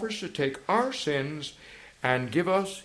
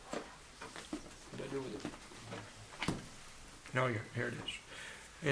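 under the breath, barely voiced; a whispered aside off the microphone; 5 semitones lower, 20 dB quieter, slower than usual. Real, not acted. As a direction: mutter it to yourself while hunting for the page. Aside while searching for book.

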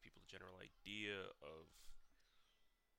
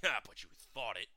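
much louder gotcha accusation; loud accusing retort; 13 dB louder than usual. Retold or imitated. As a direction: throw it back at the kid as a trap. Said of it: "He'd be like".

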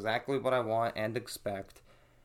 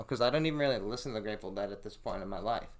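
usual speaking voice

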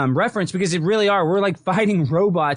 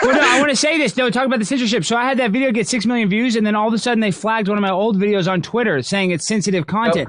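emphatic louder interruption; 5 semitones higher, 4 dB louder than usual. Real, not acted. Cutting in to make his point.

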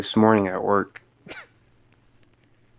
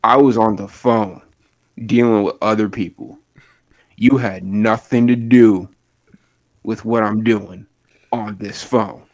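loud, deliberate telling-off; retold anger; 6 dB louder than usual. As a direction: lay it out slowly and firmly for a rude customer. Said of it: "and I'm angry. I'm pissed".

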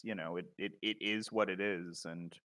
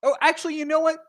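mock eager; 12 semitones higher, 8 dB louder, faster than usual. Mock eager invitation to review.

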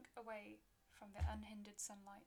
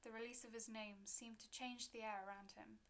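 plain reading voice

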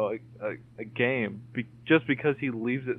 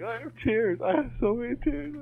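high-pitched laughing voice, much higher; laughing hard; 12 semitones higher than usual. Retold or imitated. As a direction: squeak it out through tears of laughter. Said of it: "I was laughing so hard".

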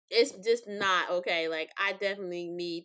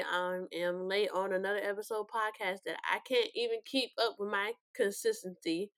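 ordinary delivery

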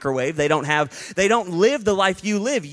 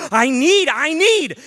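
high, louder pleading want; childish craving; 10 semitones higher, 4 dB louder, slower than usual. Retold or imitated. Preacher voicing his childhood self craving Christmas toys, pleading. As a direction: beg like a kid for toys.

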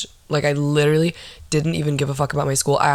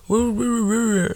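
imitated garbled mumble, higher pitched; mock mumbling; 7 semitones higher than usual. Woman retelling an encounter with a stranger, mock mumbling. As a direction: mumble wordless gibberish, mimicking someone.

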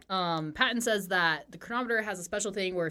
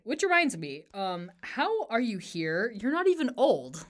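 higher, louder puzzled questioning; mock bafflement; 6 semitones higher, 5 dB louder than usual. Retelling a character questioning someone's youth, mock bafflement. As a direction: play up the baffled disbelief.

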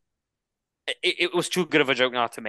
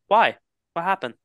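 higher, louder fake-innocent why; mock innocent surprise; 5 semitones higher, 4 dB louder than usual. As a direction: play dumb, loudly. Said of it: "not come off mute and just go".

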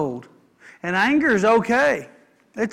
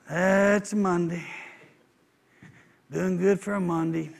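slow, low, dragging Monday groan; mock the tired Monday line; weary old saying; 3 semitones lower, 4 dB quieter than usual. Preacher mimics the weary Monday greeting, slow and low. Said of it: "You know the old saying".

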